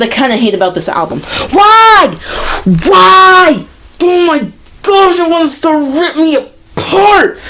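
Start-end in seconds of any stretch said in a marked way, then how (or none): 1.5-6.4 exasperated shout. Real, not acted. Reviewer yelling at the album's transitions, exasperated shout. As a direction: yell it out in disbelief.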